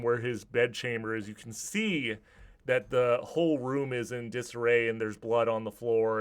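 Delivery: normal talking voice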